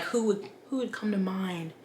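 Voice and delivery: whispered thinking aloud; whispered to self. Real, not acted. Booktuber musing about golfers, whispered to herself. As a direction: breathe it out, puzzling to yourself.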